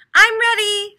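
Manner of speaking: high, loud and keen; eager little turtle. A narrator's reading. Little turtle to his mother, eager and loud. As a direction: announce you're all set. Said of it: "said Little Turtle".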